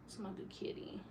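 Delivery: unvoiced tender whisper. Real, not acted. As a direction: breathe it softly to the cat. Soothing the cat.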